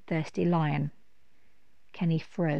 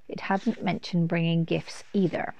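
ordinary delivery, nothing marked

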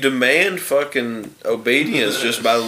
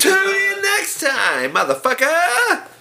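much higher, slow, hammed-up sign-off; over-the-top announcer. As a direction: yell it like a cartoon host. Outro announcer shout.

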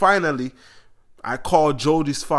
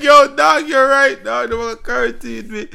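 high, loud, laughing; laughing excited; 11 semitones higher, 8 dB louder than usual. Retold or imitated. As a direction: blurt it out laughing hard. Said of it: "I'm like".

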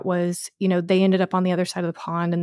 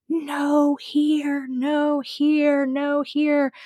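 high, sing-out recall, imitating a frantic owner; client recalling dog; 9 semitones higher, much slower than usual. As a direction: call after a runaway dog in a raised pitch. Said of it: "And she was".

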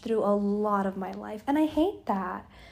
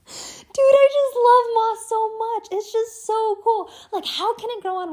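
much higher, louder squeal of delight; gushing; 11 semitones higher, 6 dB louder than usual. Real, not acted. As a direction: squeal about how much you love it. Gushing about moss.

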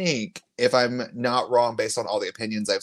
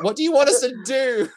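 exasperated; 12 semitones higher, 4 dB louder, faster than usual. Acted. Mock exasperation at WWE.

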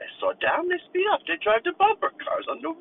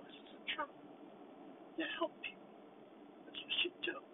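whisper; 18 dB quieter, faster than usual. Acted. Unvoiced whispered lines.

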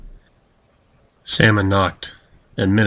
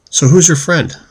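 curious sister; 8 semitones higher, 6 dB louder than usual. Acted.

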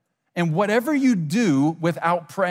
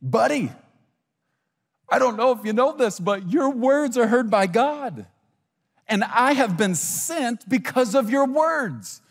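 higher, earnest preacher voicing an angel; angel's message; 6 semitones higher than usual. Angel telling Daniel his words are heard, high earnest delivery. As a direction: deliver the news in a raised pitch, slowing down. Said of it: "The angel says to Daniel".

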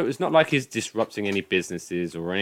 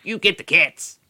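put-on higher, louder Southern-accented old man; southern drawl; 10 semitones higher, 4 dB louder than usual. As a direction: bark it like a Southern-accented grump. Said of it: "I sound like a Southern American".

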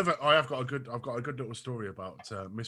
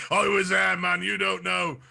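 loud, higher mock war-veteran boast; mock veteran bragging; 7 semitones higher, 9 dB louder, slower than usual. Mocking himself as the bragging key worker, loud mock boast. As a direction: play the old soldier boasting he was there. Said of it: "I'm gonna be that bull bag".

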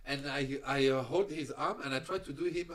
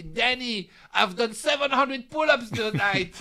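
much higher, louder boast in a cellmate's voice; imitating a proud cellmate; 10 semitones higher, 9 dB louder than usual. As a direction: play the huge cellmate bragging. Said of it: "with his big face like this".

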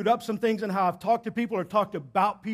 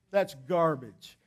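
slow dismissive; 5 semitones lower, 3 dB quieter, much slower than usual. Real, not acted. Pastor dismisses anonymous gossip.

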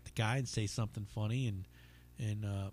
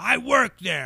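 heckler's retort; 11 semitones higher, 10 dB louder, slower than usual. Retold or imitated. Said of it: "he's like".

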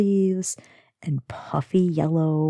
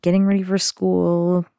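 ordinary talk, nothing marked

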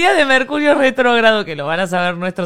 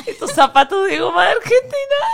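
laughing quote; 10 semitones higher, 4 dB louder than usual. Retold or imitated.

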